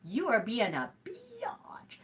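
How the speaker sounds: slow, breathy whispered insult; pretend whisper. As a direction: whisper it slowly, as a pretend rebuke.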